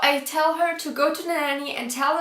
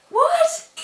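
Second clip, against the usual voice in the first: a huge high leap in disbelief; stunned outrage; shocked what; 5 dB louder than usual. Real, not acted. Stunned by nanny's excuse.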